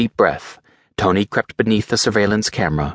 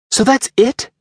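high, sharp angry demand; angry Pepper; 4 dB louder than usual. Acted. Pepper confronts Rhodey about Tony, angry high voice. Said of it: "she asked angrily".

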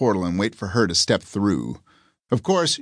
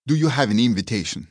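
lightly voiced Spanish-accented guard; 2 semitones higher, faster than usual. A narrator's reading. Guard stopping someone at the club door, Spanish accent. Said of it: "he asks in a Spanish accent".

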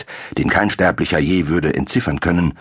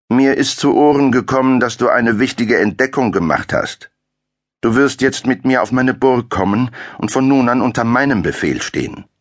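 domineering count; 7 semitones higher than usual. A narrator's reading. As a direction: order him to come along. Count demands he come.

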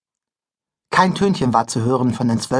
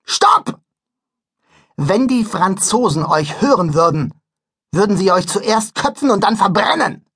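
angry scolding; 5 semitones higher than usual. Acted. Conductor stops and scolds.

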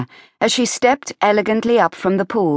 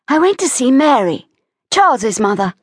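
put-on raised young woman's voice, stressing went; Evie, higher and a bit louder, matter-of-fact; Evie's voice; 8 semitones higher, 4 dB louder than usual. Evie answers Peter.

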